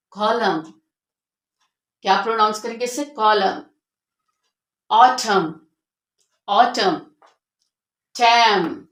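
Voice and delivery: कॉलम, ऑटम, हिम ending on m, no n; silent n. Shown as a demonstration. Teacher models silent n words for the class. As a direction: end each word on the m. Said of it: "इन सब में हमारा ये एन silent है".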